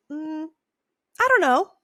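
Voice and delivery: noncommittal. Acted. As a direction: hum first, then high, loud hedging. Husband's stalling answer, repeated.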